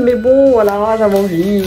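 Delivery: ordinary talk, nothing marked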